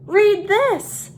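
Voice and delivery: high, loud squawk of a crow. A narrator's reading. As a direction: squawk it out like a bird flying off. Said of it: "she squawked as she flew away".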